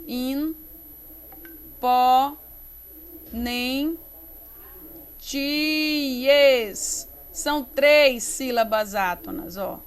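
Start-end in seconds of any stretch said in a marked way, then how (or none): 0.0-7.1 isolated syllables with long pauses, TIES fused and held; measured syllable splitting. Shown as a demonstration. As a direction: scan slowly, one syllable at a time. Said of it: "isso aqui vai ficar junto".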